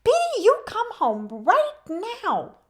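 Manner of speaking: put-on high, loud, slow old woman calling her bird home; tiny woman calling.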